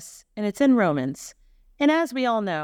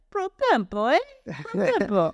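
higher, hammed-up Italian accent; Italian-uncle accent with a questioning lilt; 6 semitones higher than usual. Shown as a demonstration. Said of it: "but with an Italian accent?".

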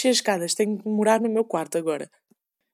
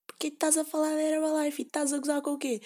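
a lightly voiced mother, higher, scolding; hint of an annoyed mother; 7 semitones higher than usual. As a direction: tell her off like a mom catching her gossiping. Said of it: "a minha mãe a gritar por mim".

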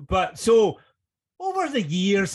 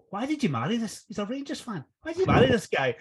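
mock teasing; 8 semitones higher than usual. Teasing Kenny's wife about Rangers.

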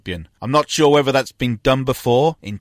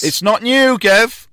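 excited; 10 semitones higher, 5 dB louder, slower than usual. Real, not acted.

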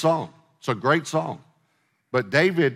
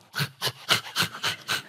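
quick, voiceless panting breaths; imitated deer panting like a dog; 5 dB quieter than usual. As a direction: pant rapidly like a dog. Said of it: "look like a dog".

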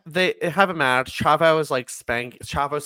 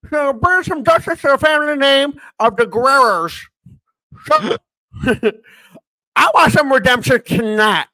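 put-on higher, louder old man voice; 10 semitones higher, 8 dB louder than usual. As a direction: ham it up like a cranky old man. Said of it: "that's my dentures voice".

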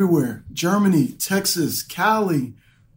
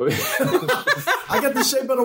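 laughing; 5 semitones higher than usual. Real, not acted. Laughing joke.

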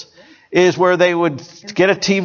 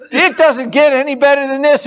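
excited; 7 semitones higher than usual. Retold or imitated. Viewer's dazzled thought.

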